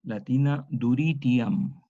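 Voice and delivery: duritiam, each syllable spelled out slowly: du-ri-ti-am; Latin word said slowly. Shown as a demonstration. Teacher sounding out duritiam for the class.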